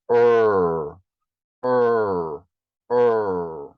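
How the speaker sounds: "er" as a long held American R, tongue curled mid-mouth; English retroflex R. Shown as a demonstration. Teacher showing the English sound, er long and curled. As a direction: draw out each "er" with no trill, separated by pauses. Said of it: "ele fica encolhida no meio".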